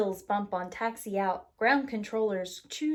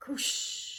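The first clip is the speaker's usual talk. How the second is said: flush whoosh. Pretend. Airplane toilet flushes, breathy whoosh. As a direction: blow it out like a flush.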